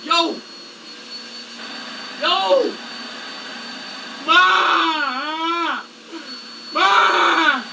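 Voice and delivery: drawn-out holler for Ma; long, open call for help. Real, not acted. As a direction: yell for mom to come. Stuck, calling out for mother.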